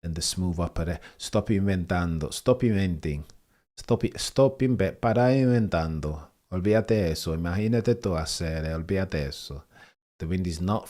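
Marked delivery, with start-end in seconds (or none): 1.2-9.6 Spanish-accented driver impression; Alonso. Streamer showing off an accent impression, Spanish-accented scolding. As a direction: mix English and Spanish in a Spanish accent. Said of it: "I've got nailed is Alonso because of the Spanish accent".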